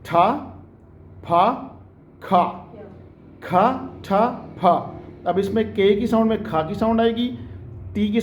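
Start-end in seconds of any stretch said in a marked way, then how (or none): 0.1-5.0 "ठा", "फा", "खा" each with a puff of air; separate, breath-released "ठा", "फा", "खा"; aspirated sounds drill. Shown as a demonstration. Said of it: "दो साउंड जिनका आपने खास ख्याल रखना है".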